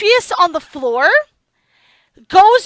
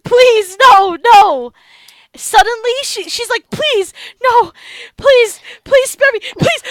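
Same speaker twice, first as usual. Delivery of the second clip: higher, pleading, desperate plea; begging for life; 5 semitones higher, 3 dB louder than usual. Acted. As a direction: beg for mercy, voice lifting. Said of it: "she's just like".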